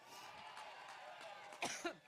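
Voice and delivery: harsh dry cough; coughing fit. Real, not acted. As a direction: cough hard, can't stop. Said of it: "my allergic reaction".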